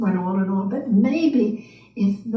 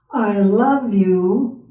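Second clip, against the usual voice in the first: slow, louder, sneering; mocking inner voice; 5 dB louder, much slower than usual. Nona's doubting inner voice mocking her, slow. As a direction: drag it out to ridicule. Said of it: "this other voice in her head was going".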